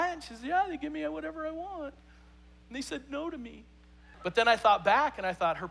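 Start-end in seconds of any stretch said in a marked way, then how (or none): 0.5-3.6 high, soft whimpering girl imitation; mock bawling. Spoiled girl about her parents, mock tearful whimper. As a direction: whine it like a pampered teen. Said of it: "she's just bawling".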